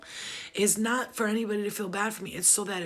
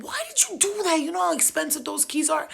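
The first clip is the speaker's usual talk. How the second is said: much higher, louder shocked question; outraged disbelief; 7 semitones higher, 3 dB louder than usual. Retold or imitated. Scolding friend who threw the key, shocked and high. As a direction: squeal it in disbelief at her.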